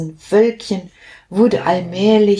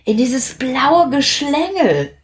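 thoughtful; 6 semitones higher than usual. Acted. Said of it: "überlegte Plitsch".

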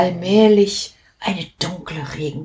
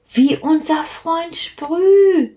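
sudden realization; 10 semitones higher, slower than usual. Acted. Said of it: "fiel Plitsch ein".

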